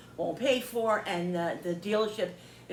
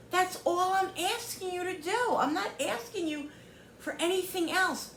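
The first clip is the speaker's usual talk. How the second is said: higher, emphatic retold scolding; exasperated demand; 7 semitones higher than usual. Customer retells demanding the seller do right, exasperated. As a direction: plead and scold at once, drawing out key words.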